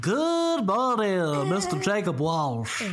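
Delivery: slow, drawn-out announcer greeting; mock grand greeting. Host hams up a formal welcome for his co-host, slow and grand. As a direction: stretch out each word like a showman.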